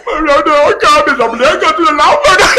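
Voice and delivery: high loud sobbing-laughing voice; hammed-up father-in-law wailing; mock crying wail. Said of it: "mamak teriak".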